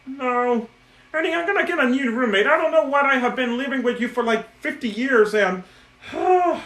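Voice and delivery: high, fast, exasperated puppet voice up to shouts; high whiny Bert scolding Ernie. Pretend. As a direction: do a fussy, fed-up Bert. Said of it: "a good bird voice".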